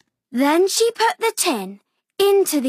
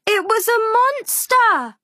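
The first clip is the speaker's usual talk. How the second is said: ordinary delivery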